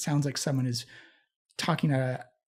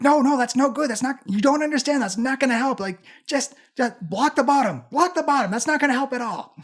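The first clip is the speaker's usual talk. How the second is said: raised, high-anxiety fretting, short of a scream; higher, louder voice of someone panicking; panicked; 8 semitones higher, 4 dB louder than usual. Acted. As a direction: fret urgently, worked up but not yelling. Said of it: "panicked, not screaming, but very elevated talking".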